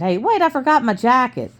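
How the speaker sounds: put-on high-pitched kid calling after the others; eager boy's high call. Boy calls out on leaving.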